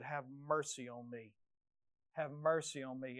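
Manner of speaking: very soft, low humble plea; whisper-close begging for mercy; hushed plea. Acted. Preacher models David's plea, soft and low. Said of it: "go back to God like David".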